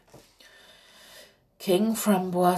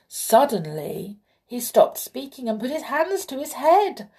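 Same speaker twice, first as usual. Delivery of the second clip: plain reading voice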